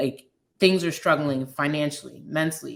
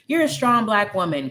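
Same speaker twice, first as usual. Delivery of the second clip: a hint of a cheerful well-meaning voice, pitched higher; quoting people, higher and louder; 6 semitones higher, 4 dB louder than usual. Friends calling her strong, lightly mimicked higher and louder. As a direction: toss off the stock praise, higher.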